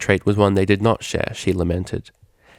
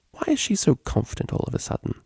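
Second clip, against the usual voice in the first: whispered thought; 9 semitones higher, 6 dB quieter than usual. A narrator's reading. Inner thought.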